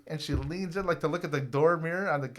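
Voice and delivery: normal talking voice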